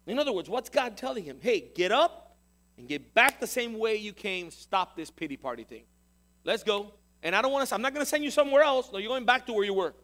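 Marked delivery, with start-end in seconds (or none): 1.4-9.9 loud, forceful orders; stern command. Acted. God ordering Elijah to stop his pity party, stern command. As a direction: rouse someone firmly from self-pity.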